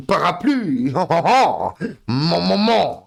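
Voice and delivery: hammed-up French accent, chuckling; mock French. Mock French accent for chat. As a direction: ham up the French words. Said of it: "That's my best French thing I can do".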